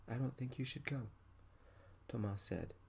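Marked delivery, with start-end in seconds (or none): none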